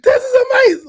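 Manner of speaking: high, loud, squealing with excitement; overexcited kid. Retold or imitated. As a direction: scream it like a boy on his first boat trip. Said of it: "like that".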